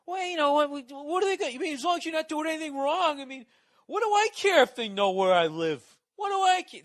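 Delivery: high, loud mocking voice of a clueless guy; mocking imitation of a careless moron; dopey know-it-all voice. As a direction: ham up a smug fool who doesn't care. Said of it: "just sitting there going like".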